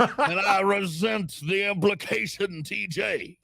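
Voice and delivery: high, loud hammed Southern drawl; Southern lawyer. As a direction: resent it like an indignant Southern lawyer. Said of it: "you sound like a Southern lawyer".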